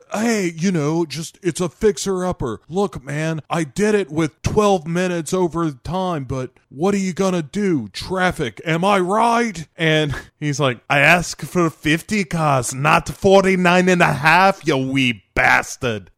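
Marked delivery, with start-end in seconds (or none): 0.1-9.7 high-pitched, casual excuse-making; Nicolas Cage. Acted. Cage excuses his lateness to Calitri, high and casual. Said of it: "And Nicolas Cage is like". 10.9-16.1 put-on loud, raised Scottish accent, angry; Calitri. Calitri rebukes Cage over the car count, angry Scottish accent. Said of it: "why he has a Scottish accent".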